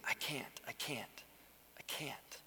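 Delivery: whispered, fading away with each I can't; weakened whisper. Acted. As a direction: breathe it out like someone worn down. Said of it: "it's a weakening".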